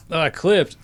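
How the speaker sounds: ordinary talk, nothing marked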